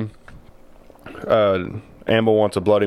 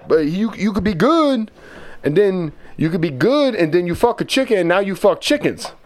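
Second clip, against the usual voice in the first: imitating grandpa; 11 semitones higher than usual. Grandson voices grandpa misquoting the chicken saying, high. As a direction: pitch it up as a flustered grandpa.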